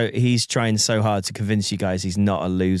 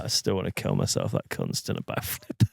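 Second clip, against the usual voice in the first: very low, soft imitated gloom; mock glum muttering; 7 dB quieter than usual. Unmoved mate after the backflip, low mock-glum mutter. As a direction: mutter it, sunk down at the end. Voices the unmoved suicidal man.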